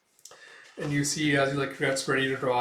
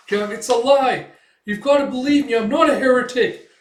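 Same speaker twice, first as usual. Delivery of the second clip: much higher and louder, begging for her life; desperate pleading; 11 semitones higher, 11 dB louder than usual. Acted. Condemned girl begging the crowd, terrified high shouts. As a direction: scream your innocence at the executioner.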